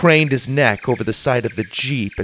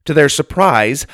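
ordinary delivery, nothing marked

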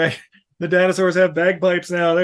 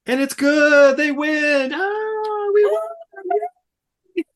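much higher, teary mock celebration; mock victory cry; 13 semitones higher, much slower than usual. Retelling the movie's win, high mock crying joy. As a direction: squeal it like a tearful winner.

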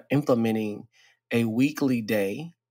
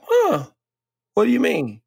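high, loud puzzled "huh"; confused surprise; 11 semitones higher, 5 dB louder than usual. Retold or imitated. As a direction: caught off guard, asking back. Retold confused reaction to friend.

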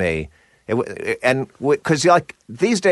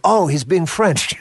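put-on higher, slow French-accented realization; drawn-out mock French accent; 7 semitones higher than usual. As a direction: imitate a Frenchman working it out. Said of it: "there was an actual French guy".

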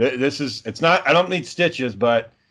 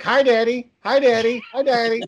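put-on higher, light child voice, cheerful; little boy voice; 7 semitones higher than usual. Son Roger greeting his dad at the door, childlike. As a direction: chirp it like a happy toddler. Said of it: "he's like".

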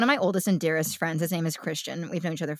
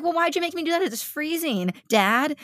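whiny complaint; 10 semitones higher than usual. Retold or imitated.

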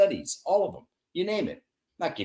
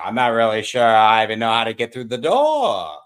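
louder, feigned confusion; hammed-up bumbling detective; Columbo impression; 2 semitones lower, 8 dB louder than usual. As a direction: act dumb to disarm. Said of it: "you gotta do the real Columbo accent".

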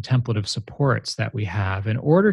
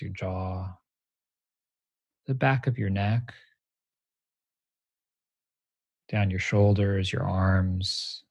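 guided meditation. Acted. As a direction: guide them gently into stillness. Leads a meditation.

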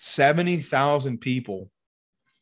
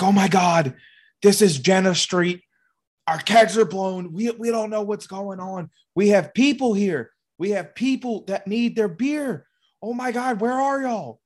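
high, frantic, rising panic; mock a man in full-blown panic; imitating a panicked man, higher and higher; 9 semitones higher, 3 dB louder than usual. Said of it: "full-blown panicking".

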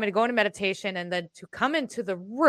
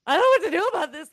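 high, fast, a little louder, panicked; retold panic; 10 semitones higher, 4 dB louder, faster than usual. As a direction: blurt it out in a rush of alarm. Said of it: "And I'm like".

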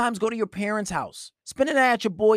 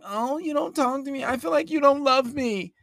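put-on higher, whiny girlfriend complaint; mock whiny girlfriend; 8 semitones higher than usual. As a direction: play the needy partner, pleading. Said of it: "So when she's like".

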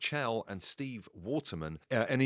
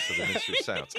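mock grand announcement; 6 dB louder, faster than usual. Punchline of nightclub name joke.

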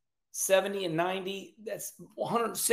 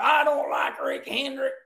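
mocking a hater; 5 semitones higher than usual. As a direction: shout it like an angry commenter. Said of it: "I see a lot of negative comments".

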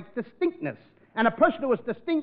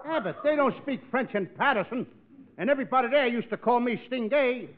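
ordinary delivery

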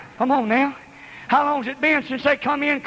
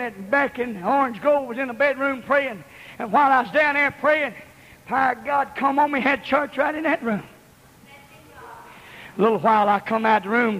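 ordinary delivery

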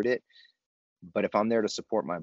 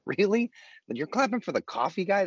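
much higher, louder incredulous question; mock disbelief; 11 semitones higher, 3 dB louder than usual. Newcomer at an LA meeting, incredulous at the applause. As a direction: sound amazed that anyone would clap for this.

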